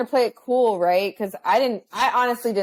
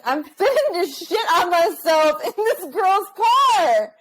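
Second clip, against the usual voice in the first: high, slow, laughing panic; panicked; 9 semitones higher, slower than usual. Retold or imitated. Retelling her stomach emergency to the host, high and panicked. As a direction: mock-desperate self-quote, laughing through it. Panicked self-quote in story.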